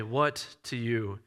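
low, slow, softened sickbed voice; hushed and slow, barely managing words; weak near-whisper. Acted. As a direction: say it quietly, like an unwell man. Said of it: "He may be whispering this".

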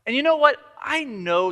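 spring the idea on him; eager aside. Retold or imitated. Widow pitching to the king, eager. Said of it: "She basically says".

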